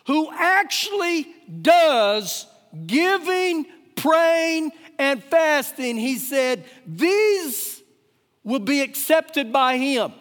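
high, deliberate preacher's delivery, word by word; emphatic preaching. Acted. Pastor listing giving, praying and fasting, emphatic preaching. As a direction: set each word apart and punch it.

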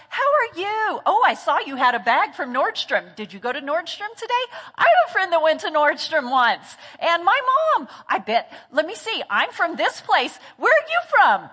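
gushing chatterbox with high, swooping pitch; talkative personality. Acted. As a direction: play an over-friendly stranger chatting nonstop. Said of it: "they're very talkative".

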